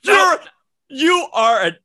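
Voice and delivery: loud, high-pitched accusation; indignant outburst. Real, not acted. Co-host calling out the surprise pick, indignant outburst. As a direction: sputter loudly in outrage.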